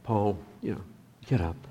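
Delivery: soft, friendly nudge to stand; gentle urging. Shown as a demonstration. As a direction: say it kindly, almost privately.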